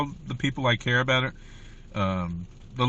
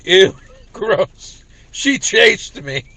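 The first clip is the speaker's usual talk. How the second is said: loud, high, breaking into laughter; laughing retelling; 6 semitones higher, 6 dB louder, much slower than usual. Real, not acted. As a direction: crack up while telling it. Laughs while retelling a taunt.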